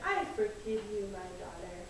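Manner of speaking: father forgiving his daughter, low and soft; gentle father. Acted. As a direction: forgive her tenderly and low. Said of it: "So Father said".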